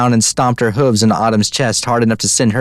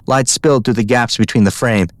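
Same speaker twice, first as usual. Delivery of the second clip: plain reading voice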